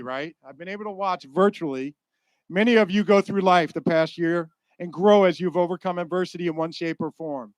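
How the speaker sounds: normal talking voice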